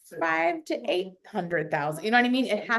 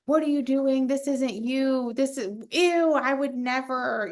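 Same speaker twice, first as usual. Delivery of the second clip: offended commenters; 6 semitones higher than usual. Retold or imitated. Voicing shocked TikTok followers reacting to luxury posts. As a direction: play the scandalized followers. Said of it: "my comments went crazy".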